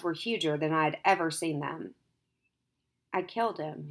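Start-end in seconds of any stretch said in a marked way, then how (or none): 3.1-3.9 much softer, slow stunned murmur. A narrator's reading. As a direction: let it fall out, dazed and quiet. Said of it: "he said slowly".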